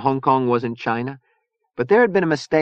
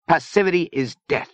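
grave, high-pitched pronouncement; 10 semitones higher than usual. A narrator's reading. Zona warning against passivity, grave. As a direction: state it as a solemn warning. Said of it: "Zona Rosa said gravely".

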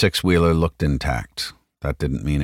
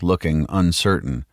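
plain reading voice

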